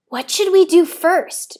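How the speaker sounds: put-on a child asking her papa eagerly; Theo's voice. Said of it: "she asked".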